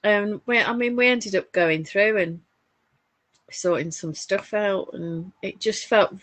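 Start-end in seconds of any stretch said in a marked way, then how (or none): none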